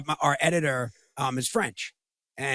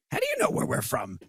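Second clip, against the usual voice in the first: incredulous question, pitched way up; mock incredulous. [name] retelling his confused reply to his wife, high mock disbelief. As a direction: play his own baffled reply, high and light. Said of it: "I was like".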